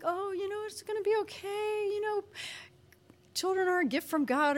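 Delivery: high, cooing friend's voice; sweet and supportive. Retold or imitated. Friend comforting a crying pregnant woman, sweetly imitated. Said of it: "She was being very sweet and supportive".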